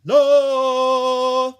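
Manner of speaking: very high, very loud trumpeting note; trumpeted note. A narrator's reading. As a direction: blast it like an elephant's trumpet. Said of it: "elephants trumpeted".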